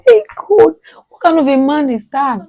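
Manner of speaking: loud, high, scandalised outburst; shocked, loud protest; outraged exclaiming. Real, not acted. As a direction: cry out in disbelief at what he did. Outraged reaction to his story.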